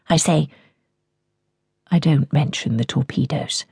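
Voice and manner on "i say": ordinary delivery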